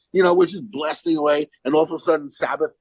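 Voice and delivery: ordinary talk, nothing marked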